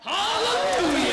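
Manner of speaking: loud, high, slow cry of hallelujah; joyful hallelujah. Acted. Mission leader crying out hallelujah to the whole meeting, loud and high. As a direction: throw it up to the rafters.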